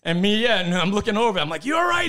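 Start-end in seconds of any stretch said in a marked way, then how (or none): 1.6-2.1 high, loud shouted check-in; calling out. Retold or imitated. Teller shouting to his friend mid-fight. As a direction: yell across the brawl.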